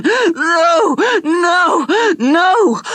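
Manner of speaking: high loud repeated screams; screaming. Acted. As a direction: scream on and on, unable to stop. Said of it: "he started to scream on and on".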